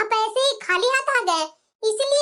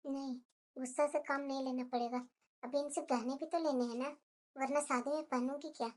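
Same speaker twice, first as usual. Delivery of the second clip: much softer and lower, under her breath; muttered aside; 10 semitones lower, 15 dB quieter than usual. Acted. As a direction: keep it to herself so the mother-in-law can't hear.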